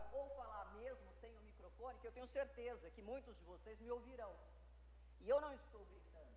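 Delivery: off mic, voice carried across the room; projecting without microphone. Shown as a demonstration. Lecturer to students, projecting off mic to reach the back. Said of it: "eu estou projetando a minha voz".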